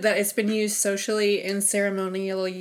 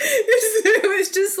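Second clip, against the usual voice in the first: high, slow laughing burst; 13 semitones higher, 6 dB louder, much slower than usual. Real, not acted. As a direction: squeal with laughter. Laughing about numb lips.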